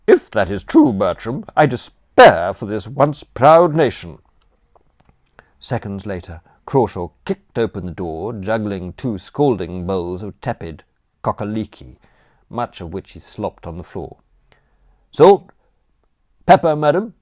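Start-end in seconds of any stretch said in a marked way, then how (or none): 0.1-4.2 high, loud, withering dowager voice; haughty aunt. Acted. Aunt to nephew Bertram, despairing grande dame scorn. 15.1-17.1 high, loud cockney manservant offering; chirpy servant barging in with condiments; cheeky butler. Acted. Butler Crawshaw to Aunt Agatha, loud chirpy cockney offer.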